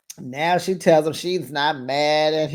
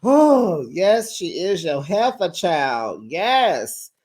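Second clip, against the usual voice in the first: sassy; 5 semitones higher, much slower than usual. Retold or imitated. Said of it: "And I'm like".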